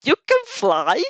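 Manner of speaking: slow, loud, disbelieving; stunned stammer. Acted. Zero stunned that you can fly, slow and loud. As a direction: gape at the flying friend in disbelief. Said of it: "he fumbles".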